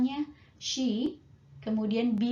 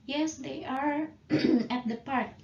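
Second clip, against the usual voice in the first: slow full answer; 4 semitones higher, 4 dB louder than usual. Shown as a demonstration. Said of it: "tidak perlu dijawab dengan kalimat lengkap".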